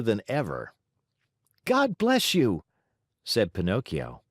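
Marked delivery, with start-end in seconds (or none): 1.6-2.6 put-on high little boy voice; chirpy puppet boy. Puppet blesses a sneeze.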